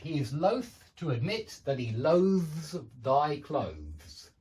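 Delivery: slow, "loath" voiceless th, "loathes thy clothes" voiced; practice sentence. Shown as a demonstration. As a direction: contrast voiceless and voiced th, slowly. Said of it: "so that you can practice".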